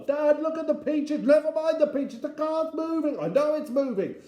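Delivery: very high, loud volley of cries; frantic shouting. Acted. Family shouting over each other as car rolls, frantic. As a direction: yell the lines in a frantic tumble.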